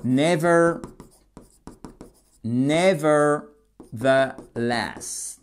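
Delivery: very slow 'nevertheless' in separate parts; drawn-out, segmented 'nevertheless'; broken-down word. Shown as a demonstration. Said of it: "contraster en disant ceci".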